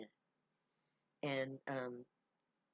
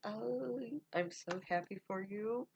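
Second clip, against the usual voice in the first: happy greeting; 8 semitones higher than usual. Acted. Healer greets the girl warmly.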